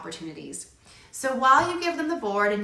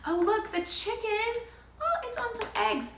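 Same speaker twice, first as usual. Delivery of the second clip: excited wonder; 11 semitones higher than usual. Shown as a demonstration. Parent commenting on the puzzle to a child, high delight. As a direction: marvel at the chicken for a toddler. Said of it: "start talking about".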